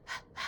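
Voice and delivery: "h, h" as soft breathy puffs of h; teacher sounding out the letter h. Shown as a demonstration. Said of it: "this starts with h".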